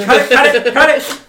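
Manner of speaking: loud, high alarmed yell; urgent shout. Real, not acted. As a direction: stop him fast. Stopping him ripping the form.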